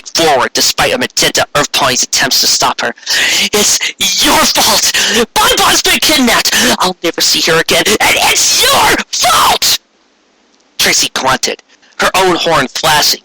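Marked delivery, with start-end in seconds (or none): 3.5-9.8 grief-stricken fury. Acted. Lyra blaming Trixie for the kidnapping, high anguished shout. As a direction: scream it through tears of rage.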